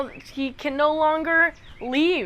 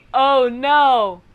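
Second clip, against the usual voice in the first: loud, hammed-up oh no; playful, emphatic dismay; dismayed echo; 6 dB louder than usual. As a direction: groan along in mock horror. Narrator echoes the man's dismay.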